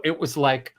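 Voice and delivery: ordinary delivery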